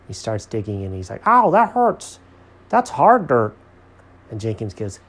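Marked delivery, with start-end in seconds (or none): 1.3-3.5 loud, high cartoon yelp; Alfred in pain. A narrator's reading. Alfred hurt while digging.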